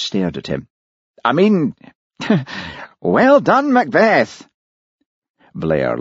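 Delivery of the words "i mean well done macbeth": high, over-hearty praise after an embarrassed cough; flustered backtracking. Acted. Embarrassed boss backtracks to congratulate Hamish, flustered high voice. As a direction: recover awkwardly, voice jumping high.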